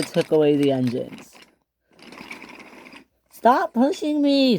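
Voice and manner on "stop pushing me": high-pitched mock scream; high, drawn-out squeal of protest. Emily to the one pushing her, high mock scream. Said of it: "screamed Emily".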